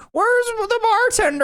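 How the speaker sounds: high, loud, caricatured guest voice; mock a guest barking for the bartender at night; demanding guest.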